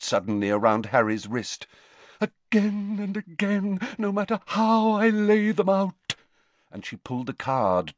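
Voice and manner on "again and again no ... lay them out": high, drawn-out seer's lament; Professor Trelawney, ominous. A narrator's reading. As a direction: build dramatically toward a louder foreboding.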